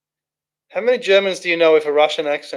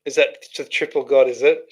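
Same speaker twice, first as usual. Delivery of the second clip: usual speaking voice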